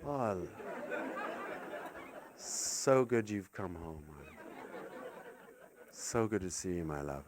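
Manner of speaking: soft, low, weary affection; tired loving parent. Acted. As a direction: welcome them home with no energy left. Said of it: "you've all slightly tired".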